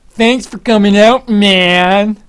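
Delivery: high, loud, slow drawn-out caster greeting; Tasteless impression. Caster Tasteless greeting a fan at the water break, loud and slow. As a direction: boom it out like a hyped caster saying hi.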